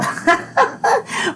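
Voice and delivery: a quick high airy giggle; breathy laugh. Real, not acted. Laughs at his own joke. (risita aireada)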